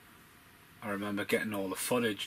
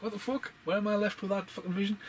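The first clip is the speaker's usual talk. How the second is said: high squeaky mock disbelief; 12 semitones higher, 3 dB louder than usual. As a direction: squeal it out in fake shock.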